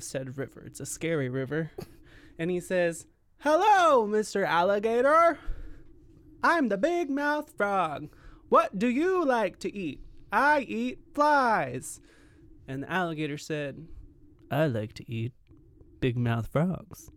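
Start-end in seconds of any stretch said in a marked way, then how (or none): 3.4-12.0 loud, slow, wide-mouthed frog voice; big mouth frog. Acted. 14.5-17.1 lower, slow predator voice; menacing alligator. Acted. Voicing the alligator.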